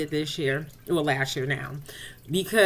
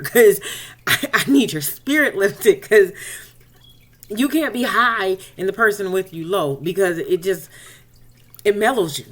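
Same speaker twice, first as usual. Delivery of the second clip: loud urging; 6 dB louder than usual. Retold or imitated. Urging cousin to get prayer.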